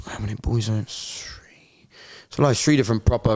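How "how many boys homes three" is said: breathy whispered aside, low and quiet. Real, not acted. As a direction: drop to a whisper as if asking yourself.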